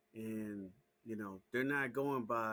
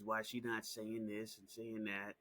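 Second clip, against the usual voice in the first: usual speaking voice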